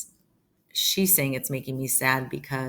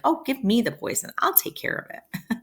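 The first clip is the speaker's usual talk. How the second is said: hammed-up fake offer, starting high; play-acted eagerness to take the job; pretending; 9 semitones higher than usual. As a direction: put on a show of volunteering. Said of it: "So she's pretending".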